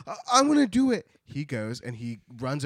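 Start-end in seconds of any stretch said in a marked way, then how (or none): 0.1-1.1 high, loud, fast eagerness; excited child. Acted. Gnome King eager to send his gnomes home, loud and high. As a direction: burst out with eagerness. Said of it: "He's like".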